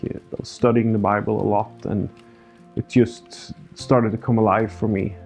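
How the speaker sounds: ordinary delivery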